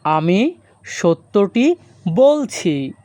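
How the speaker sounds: slow, deliberate teacher repeat; slow and clear. Shown as a demonstration. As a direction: say it slowly, word by word. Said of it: "আমাদের পরবর্তী বাক্যটি আছে".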